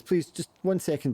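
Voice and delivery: high, harried plea; a harried parent asking for a moment; flustered mother. Acted. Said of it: "the woman's just flustered".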